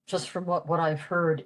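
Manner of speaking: ordinary delivery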